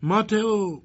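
high, loud shout of a name. Acted. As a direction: yell for Mateo from the doorway. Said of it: "و داد زد".

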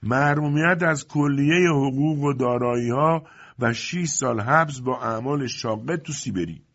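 loud announcement. A narrator's reading. Workman calls out the court's sentence, loudly. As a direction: call it out high and slow. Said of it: "کارگر با صدای بلند جواب داد".

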